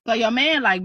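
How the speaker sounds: ordinary delivery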